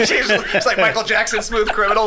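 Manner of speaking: ordinary talk, nothing marked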